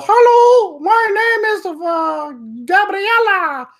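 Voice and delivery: put-on very high, drawn-out Italian-accented falsetto; hammed-up Italian accent in a sustained high pitch; Gabriella, Italian accent. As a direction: put on a silly squeaky Italian woman. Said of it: "I don't know why she's Italian".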